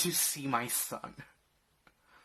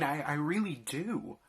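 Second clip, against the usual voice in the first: usual speaking voice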